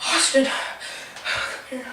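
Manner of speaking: slow stage whisper; drawn-out retold whispered summons; hushed call. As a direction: whisper him over. Retold furtive call to friend.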